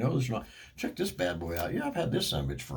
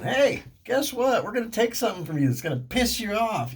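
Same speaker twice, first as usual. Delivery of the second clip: mock TV announcer; 12 semitones higher, 3 dB louder than usual. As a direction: sneer it out like a smug TV voice. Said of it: "without somebody going".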